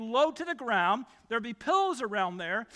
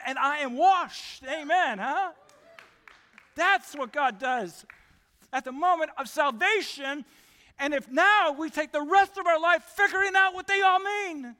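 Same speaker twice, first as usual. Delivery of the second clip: climax of praise, rising higher and louder; building preacher's shout; 5 semitones higher, 6 dB louder than usual. Real, not acted. Preacher to congregation after the list of blessings, building to a shout. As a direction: build to a loud, high-pitched peak.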